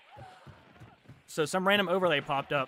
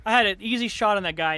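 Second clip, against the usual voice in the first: loud, raised-pitch angry complaint; pissed off; 5 semitones higher, 8 dB louder than usual. Real, not acted. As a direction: protest loudly, really pissed. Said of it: "I'm so pissed".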